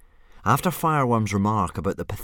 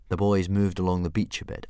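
usual speaking voice

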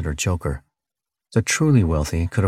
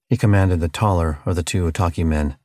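ordinary delivery

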